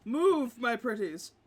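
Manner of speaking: high, loud playful order; mock villain command. Game master shifts the figures about, mock villain command. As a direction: command them like a hammed-up witch.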